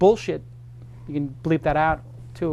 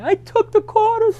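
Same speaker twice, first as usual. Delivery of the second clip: high, slow, sobbing confession; boy sobbing his confession to the bartender, high and slow; retold hysterical crying; 5 dB louder than usual. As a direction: wail it out like a guilty kid owning up. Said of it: "I started hysterical crying".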